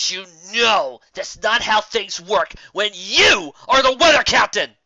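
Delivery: high, loud and slow furious bellow. Acted. Pony bellowing at Dad about the rules, furious shout. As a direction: roar it from the throat in rage. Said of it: "she bellowed".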